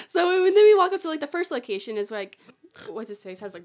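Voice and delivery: usual speaking voice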